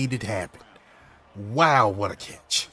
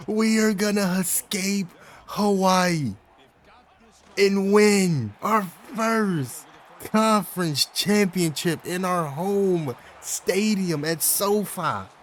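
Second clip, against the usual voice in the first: triumphant; 5 semitones higher, slower than usual. Real, not acted. Savouring the coming title.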